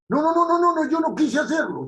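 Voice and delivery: hammed-up panicked excuse; mock protesting denial. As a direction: play the accused swearing innocence. Acted accused denying guilt.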